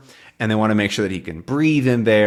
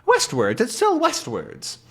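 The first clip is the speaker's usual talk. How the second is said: much higher, slow absent-minded professor; oblivious professor. Retold or imitated. Calculus keeps reading his pendulum, much higher. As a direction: insist dreamily, unaware. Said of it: "Calculus is going".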